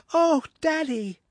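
put-on high, slow boyish cry of joy; a delighted lad calling to his father. A narrator's reading. Son greets his returning father, high joyful cry. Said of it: "he cried".

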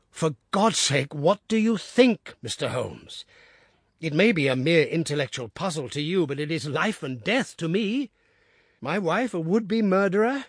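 frantic husband begging the detective for answers; desperate plea. Acted. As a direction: beg him not to play games. Desperate father pleads.

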